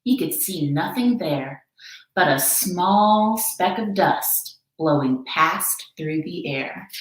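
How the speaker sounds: ordinary delivery, nothing marked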